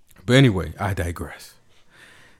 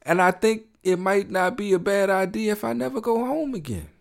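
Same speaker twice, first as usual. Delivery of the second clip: soulful [name] line; 10 semitones higher than usual. Retold or imitated. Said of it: "he say".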